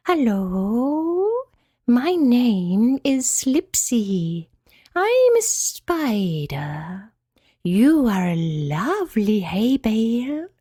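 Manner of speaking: very slow, swooping spider voice; delicate spider. A narrator's reading. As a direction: draw out every word in long glides. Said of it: "asked the delicate and hairy spider".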